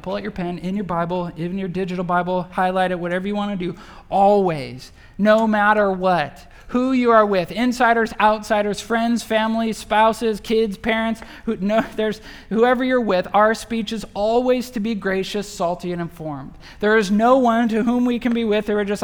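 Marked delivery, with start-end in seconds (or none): 4.1-16.5 loud, high preaching build; preaching hard. Acted. Pastor urging believers, preaching at full force. 16.8-19.0 preaching hard. Acted. Preaching at full force.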